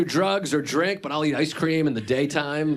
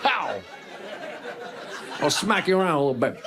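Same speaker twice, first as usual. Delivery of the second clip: a hint of a bullying tough guy, half-laughing; mock tough guy; 3 semitones higher, slower than usual. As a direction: threaten playfully, not for real. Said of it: "What if I did the rest of the show like that?".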